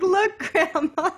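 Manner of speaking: high, loud, drawn-out joke cry; hammed-up glee, stretched out and laughing; mock gleeful showing-off. Pretending to show grandma the photo, mock gleeful.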